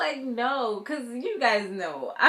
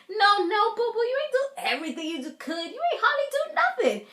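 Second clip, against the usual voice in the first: sassy scolding; 7 semitones higher than usual. Pretend. Vlogger scolding the cast member on screen, high sassy scolding. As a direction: put her in her place, high and sassy, boo-boo.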